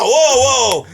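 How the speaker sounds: high, mock startled DJ; imitated alarmed emcee; taken aback. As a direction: call for calm, taken aback. DJ halting the angry crowd.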